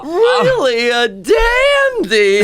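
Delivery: trembling whiny yelps, high and slow; Cowardly Lion voice. Shown as a demonstration. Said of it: "my actual Cowardly Lion voice was".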